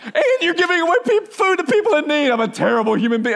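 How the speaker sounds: high-pitched mock wail, easing down in pitch; hysterical. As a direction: imitate a girl wailing in self-reproach. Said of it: "she's hysterical about it".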